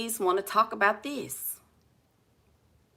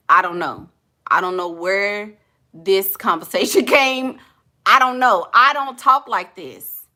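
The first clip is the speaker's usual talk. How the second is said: ordinary talk, nothing marked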